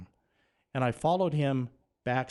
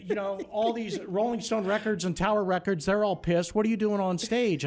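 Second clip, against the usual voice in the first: high, exasperated mock tirade; angry boss; 8 semitones higher than usual. Station owner berating his intern over the show, exasperated. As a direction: build to an indignant demand. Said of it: "chewed my ass".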